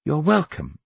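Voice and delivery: mannered motherly courtesy; Viola. A narrator's reading. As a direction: answer a polite child pleasantly. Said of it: "Viola said".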